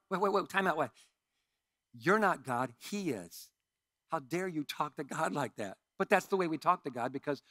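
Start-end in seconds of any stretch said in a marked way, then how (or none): none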